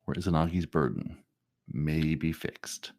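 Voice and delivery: low, soft, slow trailer narration; movie trailer voice. Acted. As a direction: stretch it out like an epic trailer, portentous pauses. Mock movie trailer opening.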